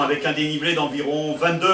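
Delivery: ordinary delivery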